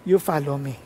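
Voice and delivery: low, unhurried divine answer; God's calm reply. Acted. God answering a worried future minister, low calm authority. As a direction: drop low and settle the matter.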